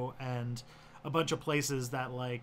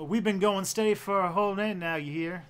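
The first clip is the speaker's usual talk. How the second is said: put-on Missouri accent, higher and louder, too much twang; Mister Beat; 5 semitones higher, 5 dB louder than usual. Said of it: "Missouri accent, I'll just, um, channel Mister Beat".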